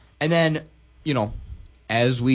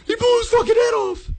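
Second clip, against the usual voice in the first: squeaky shocked scream; 4 dB louder than usual. Retold or imitated. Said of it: "He's like".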